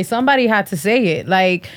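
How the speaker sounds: ordinary talk, nothing marked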